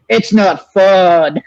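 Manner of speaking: slow, dragged-out deadpan; deadpan Stu line. Retold or imitated. Stu's dumb line said slowly and flatly. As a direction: stretch every word like a bored killer. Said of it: "he'd say something stupid like".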